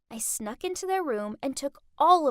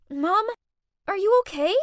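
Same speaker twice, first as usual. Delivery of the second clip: worried; 6 semitones higher, 6 dB louder than usual. Acted. Helping mom up, worried.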